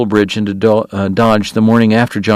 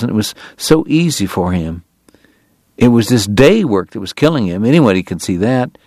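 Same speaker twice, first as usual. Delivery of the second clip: ordinary delivery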